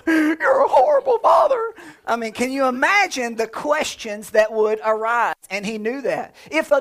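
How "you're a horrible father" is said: very high, loud, laughing shout; outraged daughter. Retold or imitated. Daughter shrieks at her father for killing the bunny, laughing. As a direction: shriek it like a horrified little girl. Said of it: "my daughter's firstborn bunny".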